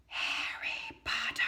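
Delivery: slow, unvoiced, eerie whisper; Voldemort's whisper. Voldemort whispering around the whole audience. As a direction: hiss the name out of the dark. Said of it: "words said with an unmistakable voice, the voice of Voldemort".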